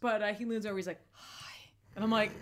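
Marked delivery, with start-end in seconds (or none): 1.1-1.4 very soft, voiceless mimicked hello; whispered greeting. Creepy stranger leans over to greet her, whispered.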